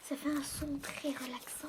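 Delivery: murmure ASMR tout près du micro; chuchotement ASMR. Acted. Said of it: "Ça fait de l'A S M R". (chuchotement ASMR)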